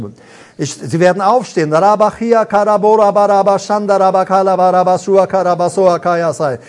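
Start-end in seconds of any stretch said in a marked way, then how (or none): 1.7-6.6 fluent, flowing glossolalia; praying in tongues. Real, not acted. Preacher praying in tongues before the congregation, flowing and fervent. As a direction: let the tongues pour out steadily.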